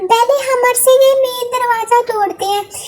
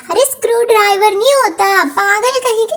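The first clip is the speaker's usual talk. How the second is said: usual speaking voice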